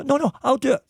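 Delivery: high, huffy refusal of help; high huffy "I'll do it"; stubborn insistence. Retold or imitated. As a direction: pitch it up, proud and stubborn. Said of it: "Sandy insists".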